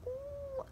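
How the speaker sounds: squeaky high embarrassed ooh; awkward high ooh. Retold or imitated. Caught out by her friend, high awkward ooh.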